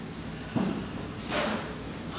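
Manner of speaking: a long voiceless yawn sigh. Shown as a demonstration. Teacher shows the class a full yawn, a long voiceless sigh. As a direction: let a full yawn out on air alone. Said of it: "So it's gonna look like this".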